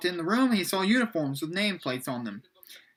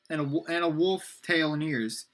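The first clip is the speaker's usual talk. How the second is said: ordinary delivery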